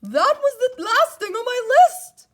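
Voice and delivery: high, loud indignant shout. A narrator's reading. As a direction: keep yelling the complaint. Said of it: "shouted Toad".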